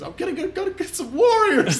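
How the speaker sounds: high cartoon voice. Quoting Bug's Life character.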